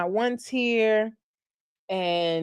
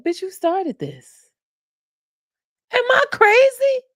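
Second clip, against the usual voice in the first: much higher, incredulous outburst; can't believe what she's seeing; exasperated disbelief; 3 dB louder than usual. Real, not acted. Vlogger ranting at [name] on TV, high incredulous outburst.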